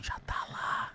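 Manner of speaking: leaning in to whisper a secret; sussurrando. Real, not acted. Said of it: "Eu vou falar baixinho". (falando baixinho, sussurrado)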